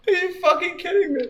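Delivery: high, quick, incredulous aside; real disbelief, voice pushed up high and hurried; disbelieving. Real, not acted. Reader appalled at the fanfic, high hurried disbelief. Reader reacts to absurd story.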